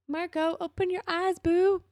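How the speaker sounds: high-pitched cooing plea; affectionate coaxing. Pretend. Viewer to scared, insecure Marco, gently coaxing. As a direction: coax him sweetly, high up in the voice.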